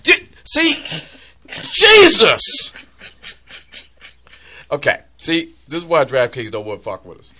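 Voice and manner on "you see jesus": high loud exasperated protest. Real, not acted. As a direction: yell back, exasperated. Protests wife's jab loudly.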